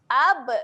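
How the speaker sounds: "Ab" with a loud echo on the b; bounced ba. Shown as a demonstration. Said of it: "Huruf ba ketika mati sifatnya ada lantun". ("Ab" dengan qalqalah)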